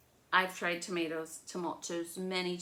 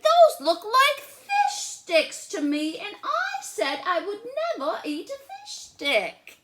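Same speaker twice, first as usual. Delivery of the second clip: put-on much higher, louder, slow stubborn little girl; Lola refusing; 12 dB louder, slower than usual. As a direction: drag it out like a picky child. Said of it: "she said".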